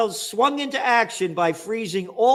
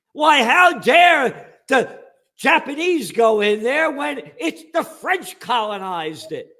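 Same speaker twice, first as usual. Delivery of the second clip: sarcastic indignant shout, higher and louder; mock outrage; 5 semitones higher, 6 dB louder than usual. Host mocking official history, sarcastic outraged shout. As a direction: ham up the fake indignation.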